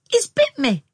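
high, loud cry of shock. A narrator's reading. Anne telling her sister the mole bit her, shocked cry. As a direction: yelp it out, pitched high. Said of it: "she cried".

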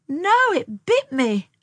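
high, loud, drawn-out protest; slow, high-pitched indignation; indignant. A narrator's reading. Sister explaining the dead mole to Tom, indignant.

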